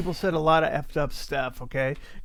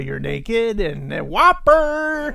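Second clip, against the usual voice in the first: louder, slower, dragged out; loud drawn-out voice; 7 semitones higher, 7 dB louder, slower than usual. Retold or imitated. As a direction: stretch it out and belt it. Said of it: "And I'm like".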